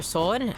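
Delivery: "Samhain" said in two variants, one after the other; two ways of saying Samhain. Shown as a demonstration. Streamer unsure of an Irish holiday's name, offering both pronunciations. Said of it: "I always forget".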